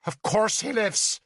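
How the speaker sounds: high, cold and scornful. Acted. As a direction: sneer it down at him. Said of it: "Nemui's voice was filled with scorn".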